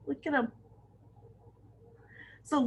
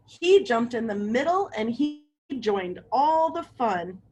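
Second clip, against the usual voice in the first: ordinary delivery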